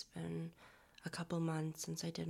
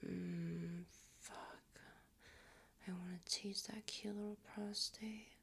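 sultry whisper; 2 semitones higher, 6 dB quieter than usual. Acted. Creator talking to an imagined partner in a teasing whisper. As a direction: murmur it close to the mic like a tease.

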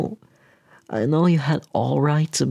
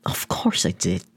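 self-blaming tearful whisper; teary whisper. Acted. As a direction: mutter it through tears. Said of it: "I'm crying".

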